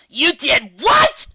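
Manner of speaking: high, loud and drawn out; shout. Acted. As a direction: explode in disbelief. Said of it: "Rainbow Dash shouted".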